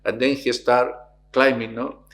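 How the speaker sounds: normal talking voice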